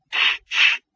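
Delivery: voiceless shushing; hushing. A narrator's reading. Visiting dog hushes his chained companion, shushing. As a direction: shush with no voice at all. Gaspode hushes the barking Laddie.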